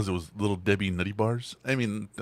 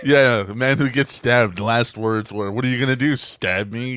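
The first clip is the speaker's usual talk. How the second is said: loud amused retelling; 3 semitones higher, 8 dB louder than usual. Real, not acted. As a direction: belt out the punchline. Laughing retelling famous last words.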